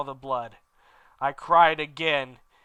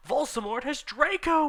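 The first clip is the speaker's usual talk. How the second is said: much higher urgent cry; shouting; 12 semitones higher than usual. Acted. Students shouting the news at Dumbledore. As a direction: blurt it out in alarm. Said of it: "we shouted at the same time".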